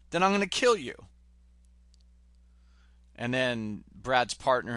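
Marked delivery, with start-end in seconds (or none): 0.1-0.9 retold threat. Retold gunpoint threat from film.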